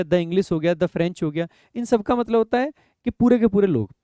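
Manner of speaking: ordinary talk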